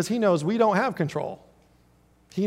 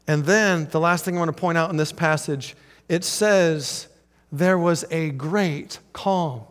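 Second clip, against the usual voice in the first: ordinary delivery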